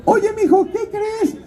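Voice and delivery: very high godmother caricature. Retold or imitated. Godmother phoning her godson with news, in a very high put-on voice. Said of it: "me vuelve a hablar".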